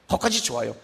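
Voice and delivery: usual speaking voice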